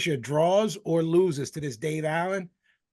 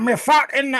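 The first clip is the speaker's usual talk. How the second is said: high, loud, hammed-up pirate; 9 semitones higher, 8 dB louder than usual. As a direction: growl it out like an old pirate. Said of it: "he's talking like a pirate".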